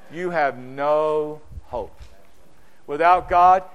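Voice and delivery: ordinary delivery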